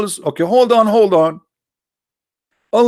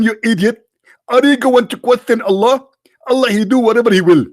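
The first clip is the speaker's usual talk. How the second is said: mocking high preacher voice, louder; Zakir Naik impression; 7 semitones higher, 4 dB louder than usual. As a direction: scold the questioner with smug certainty. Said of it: "I say to him".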